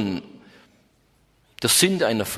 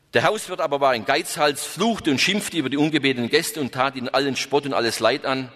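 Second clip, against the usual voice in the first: ordinary talk, nothing marked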